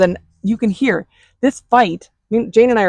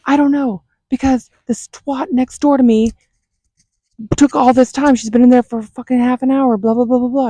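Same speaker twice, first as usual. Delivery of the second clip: mock angry rant; 5 semitones higher than usual. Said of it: "starts screaming".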